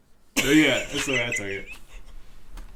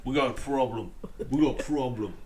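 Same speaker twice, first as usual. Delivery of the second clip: menacing Butcher; 2 semitones lower than usual. Shown as a demonstration. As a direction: growl it like Butcher. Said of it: "Can you do it again?".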